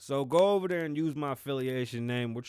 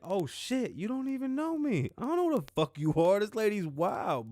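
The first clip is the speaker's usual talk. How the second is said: shocked exclaim; 8 semitones higher than usual. Retold or imitated. Reacting to a stranger calling him stupid, mock shocked high squeal. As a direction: pitch shoots way up in mock shock.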